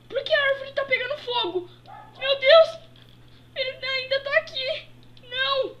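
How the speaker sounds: high, loud, fast alarmed cries; panicked. Acted. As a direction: panic as the fire starts. Tree on fire, villain returns.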